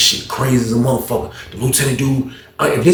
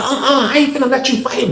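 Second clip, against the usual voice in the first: much higher, defiant refusal; high protesting boy; 12 semitones higher than usual. Retold or imitated. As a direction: block him, voice way up. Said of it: "The boy was like".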